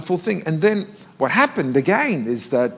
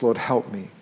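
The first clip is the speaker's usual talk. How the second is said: softer, lower prayer line for the congregation; prayer to repeat; 6 semitones lower, 6 dB quieter than usual. Shown as a demonstration. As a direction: lead them gently in a short prayer. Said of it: "I want you to say this".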